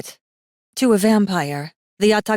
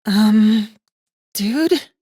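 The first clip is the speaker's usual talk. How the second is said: put-on higher, louder, hesitant young woman; awkward girl; 4 semitones higher, 7 dB louder than usual. Girl nudging the chained demon, awkward and higher. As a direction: break in awkwardly, a bit high. Girl interrupts his brooding.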